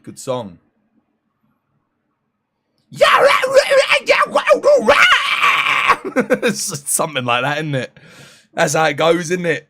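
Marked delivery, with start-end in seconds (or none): 2.9-6.5 high, loud screeched gibberish; mock metal screech. Streamer imitates a Children of Bodom song, screeching. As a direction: screech like a death metal singer.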